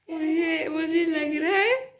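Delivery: giggly, sleepy laughter; laughing. Real, not acted. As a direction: voice breaking into laughter. Laughing fit while sleepy.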